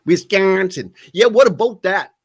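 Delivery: put-on Wisconsin accent. Host mocking Wisconsin accents to his co-hosts, in a Wisconsin accent. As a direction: lean into the northern Wisconsin vowels. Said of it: "Wisconsin accents".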